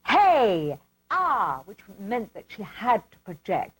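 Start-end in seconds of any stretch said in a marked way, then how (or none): 0.1-1.6 forceful "hey" falling into an open "ah"; projected vocal exercise. Shown as a demonstration. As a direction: push the voice out with power. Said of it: "used to have to keep doing with her".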